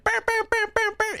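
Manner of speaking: high buzzing air-horn noise with the lips; mouth air horn. Retold or imitated. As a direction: fake the soundboard air horn. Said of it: "I don't have the burr, burr, burr".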